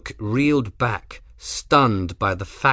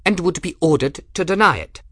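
Rook's voice; 8 semitones higher, 4 dB louder, faster than usual. Acted. Rook gloating.

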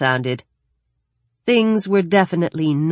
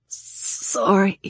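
whispery, higher-pitched apology; thin, cried-out voice; 5 semitones higher than usual. Acted. Daniel apologises after a long cry, thin and frail. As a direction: barely get it out after crying. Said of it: "His voice was thinner".

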